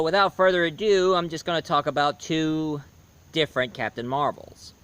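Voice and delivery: usual speaking voice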